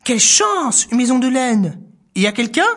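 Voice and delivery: a thrilled little mouse calling in; delighted mouse. Acted. Mouse finding the mitten, high and delighted.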